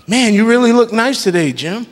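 imitating a flatterer, high-pitched; gushing compliment. Preacher acting out someone complimenting Jim, high and gushing. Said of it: "they start complimenting you".

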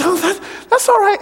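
high, flustered put-on teacher voice. Teacher brushes off the boy's news, high and flustered. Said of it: "And she says".

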